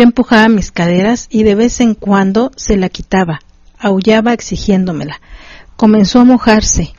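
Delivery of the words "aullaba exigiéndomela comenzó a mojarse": plain reading voice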